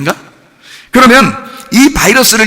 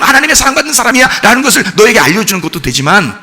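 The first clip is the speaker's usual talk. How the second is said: ordinary talk, nothing marked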